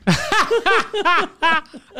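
high, loud burst of laughter; long high-pitched real laugh; loud laughing fit. Real, not acted. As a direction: crack up loudly at the punchline. Own punchline gets big laugh.

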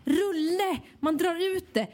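high, insistent mock desperation; exasperated explaining. Care worker desperately explains tape to old lady, high and exasperated. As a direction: spell it out, pitched high in frustration.

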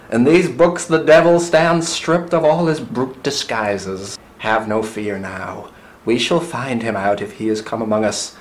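put-on slow, deliberate declamation in an American accent; put-on New England American accent; Connecticut accent. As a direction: proclaim it grandly with a Connecticut accent. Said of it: "That wasn't a terrible American accent".

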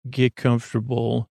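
ordinary talk

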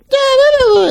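very high, loud mocking squeal; mock shrill blah. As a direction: squeal nonsense at them. Mocking the woman's yelling.

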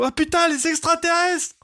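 high frantic cry of alarm; yelping in sudden panic; panicked yell. Retold or imitated. Imagined Ryle panicking at aliens.

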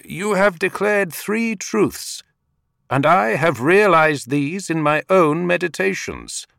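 high, loud call into a crater; shouting down. A narrator's reading. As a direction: shout it down into the pit. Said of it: "The Buddha-to-be shouted down".

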